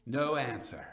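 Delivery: measured, grave storyteller's narration; dramatic story reading. A narrator's reading. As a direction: read it like a gothic tale by candlelight. Reads Poe story aloud.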